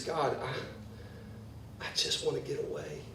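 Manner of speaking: breathy, weary whisper of wanting to escape. Acted. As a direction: hush it as if wanting to hide. Despairing psalm paraphrase.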